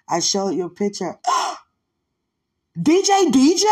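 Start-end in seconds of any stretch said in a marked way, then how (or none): 1.2-3.7 high, loud gasp of surprise. Retold or imitated. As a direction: gasp and cry his name, high and loud. Friend sees the picture.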